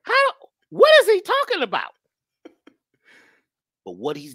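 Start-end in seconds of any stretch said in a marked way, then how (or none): 0.0-1.9 mock bafflement. Mock confused caller.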